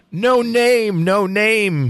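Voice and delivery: high, ringing cry with long drawn-out words; narrated shout. A narrator's reading. Boy answering Lancelot's challenge, high theatrical shout. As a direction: call it out as a storyteller's shout. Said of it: "he shouted".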